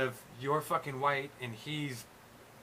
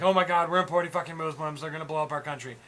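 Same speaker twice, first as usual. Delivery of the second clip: louder, hammed-up panic; mock freak-out; 3 semitones higher, 9 dB louder than usual. Mimicking everyone freaking out over people brought over, mock panic. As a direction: imitate people freaking out, loud and alarmed. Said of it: "everyone's freaking out".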